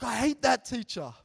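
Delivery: mock indignant schoolgirl complaint; retold angry outburst. Teacher retelling a worked-up student's complaint, mock angry outburst.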